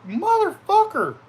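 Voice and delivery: high, loud yell of pain, stretched out. Retold or imitated. Stepping on thumbtacks his friend left, a loud high yell. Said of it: "And I was like".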